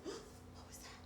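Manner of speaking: breathless high whisper of a startled pig; whispered thought. A narrator's reading. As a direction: hush it like a thought in your head. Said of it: "he thought".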